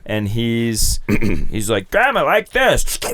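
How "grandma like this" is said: put-on high, slow child voice; a five-year-old showing grandma how; little kid voice. Kid Timmy voiced teaching grandma.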